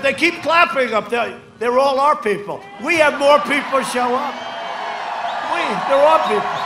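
mock his worked-up protest at full volume; agitated mock complaint. Rally speaker imitates rival griping about clapping crowd, agitated shouting. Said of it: "No, he's very agitated".

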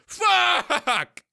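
high furious yell at the screen; frustrated shout. Real, not acted. Gamer yelling at the screen in real frustration. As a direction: explode at your own blunder.

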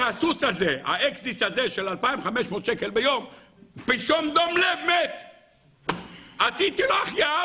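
acted frantic report, climbing high; worked-up servant, pitch soaring by the end; agitated servant. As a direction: break the bad news in rising alarm. Servant reports horse's sudden death.

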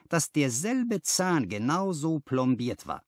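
plain reading voice

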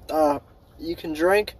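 normal talking voice

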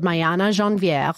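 brand name in showy French; lightly flourished French accent; French pronunciation. Shown as a demonstration. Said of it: "go seamlessly into a French accent".

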